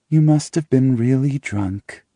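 slow and deliberate; slowly. A narrator's reading. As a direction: draw each word out slowly. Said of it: "she says slowly".

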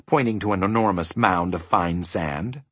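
plain reading voice

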